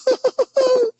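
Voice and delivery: shrill cartoon giggle; high squealing laugh. Laughs after Tigger voice.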